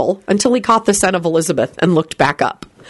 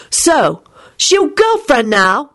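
high, drawn-out nosy question; interested; 8 semitones higher, slower than usual. A narrator's reading. Timothy prying about Lupin's girlfriend, interested. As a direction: blurt it out with interest, pitched up. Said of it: "asked Lupin with interest".

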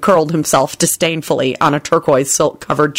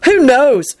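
pitched-up impatient retort; 7 semitones higher, 4 dB louder than usual. A narrator's reading. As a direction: brush it off impatiently. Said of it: "Tim responded impatiently".

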